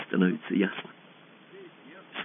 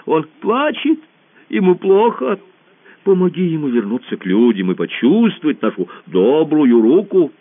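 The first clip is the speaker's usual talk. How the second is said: coaxing emperor; 7 semitones higher than usual. Acted. Emperor coaxes Alice.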